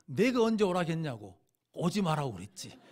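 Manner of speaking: loud scolding, half-acted; crossing guard scolding the driver, loud mock anger; retold angry outburst. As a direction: bark back like an irritated crossing guard. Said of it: "화를 버럭 내는 거예요".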